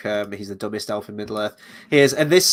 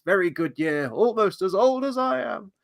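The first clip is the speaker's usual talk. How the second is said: put-on imagined old elf line; 8 semitones higher than usual. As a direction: savour the vintage like a pompous old elf. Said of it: "I thought it was gonna be like".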